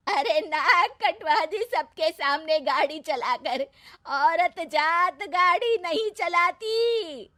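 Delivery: loud, slow scolding shout; angry yelling. A narrator's reading. Mother-in-law yells at the bride for shaming her, loud and slow. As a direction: drag out every word in fury. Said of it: "बहू को चिल्ला के बुलाती है".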